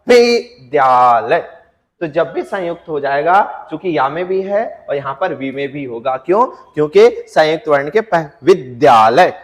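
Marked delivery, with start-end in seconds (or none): 0.1-1.4 विद्यालय very slowly, weight on विद्; teacher models विद्यालय slowly with stress; slow stressed demo. Shown as a demonstration. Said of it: "आप बताइए किसमें होगा?". 8.4-9.3 विद्यालय with विद् pressed; stressed demo. Shown as a demonstration. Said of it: "जो आपको जोर से सुनाई देगा".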